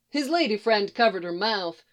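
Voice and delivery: usual speaking voice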